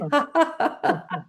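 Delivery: high, short peal of laughter; exercise laugh. Shown as a demonstration. Teacher leads stamping of the feet, high laugh. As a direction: laugh as you stamp along.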